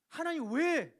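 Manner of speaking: loud high burst on the opening, then easing slightly; acted anguished prayer; crying out. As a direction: plead with God at full voice. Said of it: "라고 부르짖을 수 있어야 돼요".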